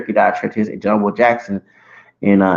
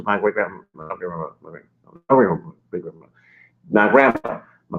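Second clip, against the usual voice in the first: choked up; 5 semitones lower, 5 dB quieter, faster than usual. Real, not acted. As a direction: voice breaking and fading to almost nothing. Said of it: "I get emotional talking about it".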